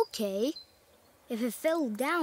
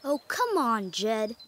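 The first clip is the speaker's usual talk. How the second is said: ordinary delivery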